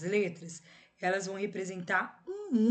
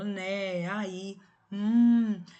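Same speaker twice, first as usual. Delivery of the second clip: né, aí, hum each drawn out; conversational fillers; 6 dB louder, much slower than usual. Shown as a demonstration. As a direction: stretch "né", "aí" and a long "hum". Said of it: "quando a gente tá conversando".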